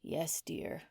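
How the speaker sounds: whispered, beaten reply; husband giving in to his wife, whispered; defeated. Acted. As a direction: give in with a soft whisper. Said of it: "Darnell sounded defeated".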